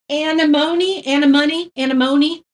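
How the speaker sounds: anemone as AN-uh-MOH-nee, repeated tentatively; mispronunciation. Real, not acted. Painter testing the flower's name, anemone tried as AN-uh-MOH-nee. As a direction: try out anemone as an-uh-MOAN-ee, each ending rising. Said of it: "I kept saying anemone".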